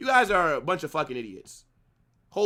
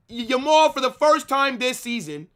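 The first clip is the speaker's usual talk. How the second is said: quoting himself louder; 6 semitones higher, 4 dB louder than usual. Retold or imitated. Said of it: "I literally said".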